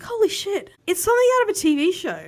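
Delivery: real disbelief, voice leaping up; shocked disbelief. Real, not acted. Host to co-host, stunned by the funeral speech, shocked and rushed. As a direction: pitch jumps high on holy shit, then rushed.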